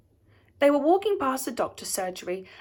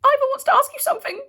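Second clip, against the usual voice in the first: high, fast, trembling with fear; nervous squeak; 14 semitones higher, 5 dB louder, faster than usual. A narrator's reading. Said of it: "In a trembling voice and physically shaking".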